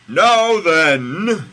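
high, very loud shout; God in capital letters. A narrator's reading. Host rereading God's supposedly calm line, bellowing. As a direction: bellow it, wildly uncalm. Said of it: "it's all in capital letters".

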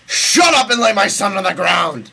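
loud, punchy shouted order; God shouting. Acted. Co-host correcting how God would say it, shouting. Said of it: "I think it's more like".

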